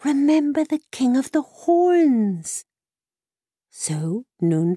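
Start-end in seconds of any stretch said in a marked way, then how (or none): 0.0-2.6 high, slow horse-like voice; whickering mare. Acted. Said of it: "who tugged at his tunic, saying".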